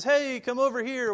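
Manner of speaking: imitating a stranger calling out. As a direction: call the sheep over, lightly acted. Another voice calling the sheep away.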